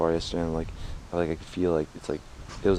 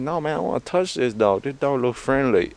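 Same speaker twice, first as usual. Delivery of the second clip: higher, louder mock kid voice; imitating his son; 7 semitones higher, 6 dB louder than usual. Dad voicing son wanting to touch the dog, higher mock kid. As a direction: play the stubborn kid who wants to pet the dog. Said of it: "He's like".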